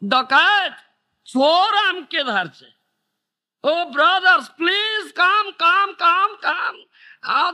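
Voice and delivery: high, loud cries for help; desperate shout for help. Acted. Trapped traveller shouting to brothers for rescue, loud. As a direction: yell to the villagers to come save you. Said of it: "You must shout".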